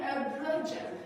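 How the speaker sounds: "broad jump" said with an English accent, English R. Shown as a demonstration. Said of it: "Avec l'accent anglais".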